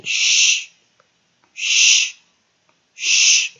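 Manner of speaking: sh held long, no vowel; three separate, strong sh sounds; isolated sound. Shown as a demonstration. Teacher showing how T I sounds, long loud sh. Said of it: "都可以念sh".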